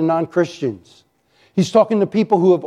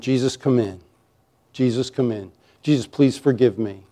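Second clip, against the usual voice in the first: low, softened plea to Jesus; quiet prayer; 5 semitones lower, 4 dB quieter than usual. Acted. Sinner asking Jesus to come in, low and quiet. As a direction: pray it gently, pitch dropped.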